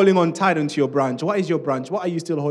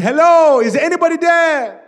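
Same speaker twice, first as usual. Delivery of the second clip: high, loud cry from a cliff; stretched, high-pitched yell for rescue; screaming for help; 13 semitones higher, 11 dB louder than usual. Acted. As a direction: call out desperately into the distance. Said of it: "Jack was screaming".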